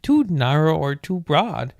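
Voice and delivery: ordinary talk, nothing marked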